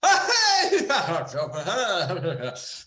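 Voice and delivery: loud, high nonsense yelling; hammed-up stage-Irish hollering; mock shouting noises. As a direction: shout gibberish in a parody Irish accent. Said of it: "it's just all the shouting noises".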